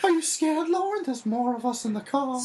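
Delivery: high, teasing clown taunt. Pretend. Teasing Lauren as the clown from the car, high taunting. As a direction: spook her like a clown would.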